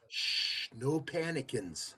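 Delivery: whispered, slow no panicins. Pretend. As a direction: breathe it out slowly, hushed. Whispered catchphrase, answering partner.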